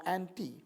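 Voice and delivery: anti said as "AN-tee"; variant pronunciation. Shown as a demonstration. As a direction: end anti on a long "ee" sound. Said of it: "the Greek word anti".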